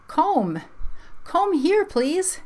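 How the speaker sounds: "Comb" with a long o, for come. Shown as a demonstration. Teacher shows what we don't say, come pronounced "Comb". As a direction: say come wrongly as "Comb", vowel saying its name. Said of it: "We don't say that".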